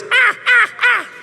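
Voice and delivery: high loud yelping ahs. Retold or imitated. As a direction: yelp in high bursts.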